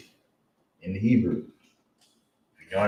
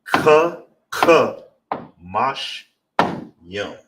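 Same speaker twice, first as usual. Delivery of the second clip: teacher chaining the syllables with heavy ka; stressed ka; 2 semitones higher, 8 dB louder, much slower than usual. Shown as a demonstration. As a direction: drive the ka syllables, ease off on mash yum. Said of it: "So all together".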